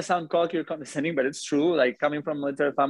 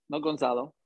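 ordinary talk

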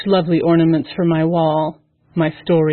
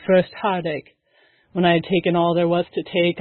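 plain reading voice

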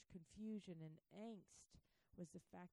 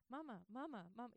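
put-on nagging kids; 5 semitones higher, 4 dB louder, faster than usual. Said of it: "they just nonstop".

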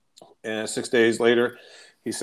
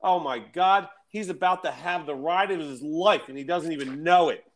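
higher, hammed-up delight; gleeful anticipation; 5 semitones higher than usual. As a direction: imitate him thrilled for an unsuspecting driver. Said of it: "he goes".

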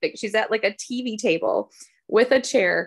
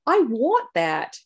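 put-on British accent; 3 semitones higher, much slower than usual. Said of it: "with her British accent".

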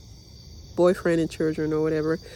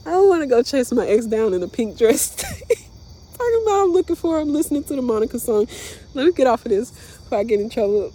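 giggling through the words, voice higher; laughing; 5 semitones higher, 4 dB louder than usual. Real, not acted. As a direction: laugh while talking, pitched up. Laughing through silly joke.

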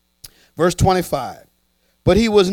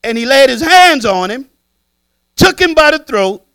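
raised, louder storytelling of the grab; the brother; 8 semitones higher, 7 dB louder than usual. Acted. Preacher acting out the servant grabbing his debtor, louder and higher. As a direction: build it up loud and high as the brother. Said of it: "watch the brother".

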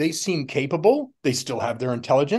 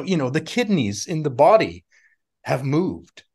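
normal talking voice

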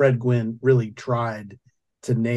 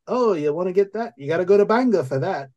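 put-on higher-pitched small-town Maine accent; Maine old-timer; 7 semitones higher, faster than usual. Old timer giving small-town directions, higher-pitched Maine accent. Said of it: "a lot of old timers like that".

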